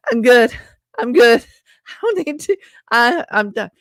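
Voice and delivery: laughing refusal. Retold or imitated. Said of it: "I'm like".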